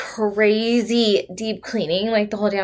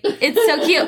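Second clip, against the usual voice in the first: normal talking voice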